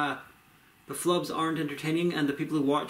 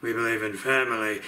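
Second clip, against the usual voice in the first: bad Vin Diesel impression; 5 semitones lower than usual. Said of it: "bad Vin Diesel impression".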